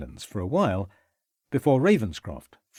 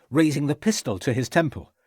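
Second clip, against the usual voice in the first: plain reading voice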